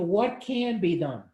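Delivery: usual speaking voice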